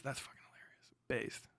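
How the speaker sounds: soft breathy aside; whisper. Real, not acted. Streamer reacting to Cillian Murphy story, whispered aside. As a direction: whisper it low to yourself.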